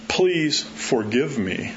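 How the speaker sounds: slow, soft please forgive me; slow humble apology; slow sincere plea. Shown as a demonstration. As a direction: draw it out humbly. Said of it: "I've never heard anybody go".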